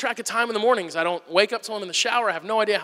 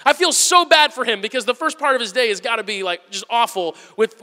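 usual speaking voice

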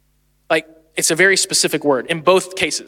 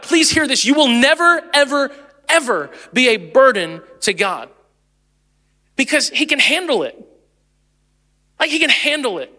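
urgent pleading sermon voice, pitched up; fervent preaching; 4 semitones higher than usual. Real, not acted. Pastor urging the congregation, fervent and pitched up. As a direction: press it home to the church.

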